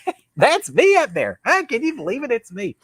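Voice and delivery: high, thrilled imagined outburst; proud, high-pitched mock amazement; excited boast. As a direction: act amazed to spot yourself on screen. Imagines watching himself on screen.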